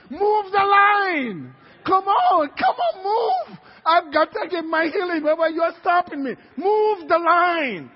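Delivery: loud, high, drawn-out yelling; impatient shouting. Acted. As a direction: holler up the queue in exasperation. Impatient man yells up the line.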